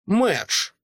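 ordinary delivery, nothing marked

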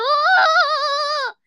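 high, excited cheer. Real, not acted. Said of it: "どっからその声出してる？".

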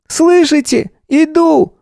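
put-on high, loud child's shout; calling out. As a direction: yell it down from the tree. Boy calling out from the tree.

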